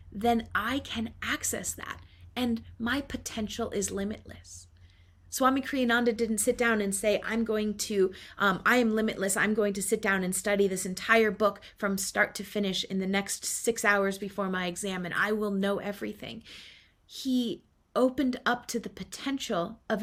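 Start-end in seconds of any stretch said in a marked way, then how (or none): none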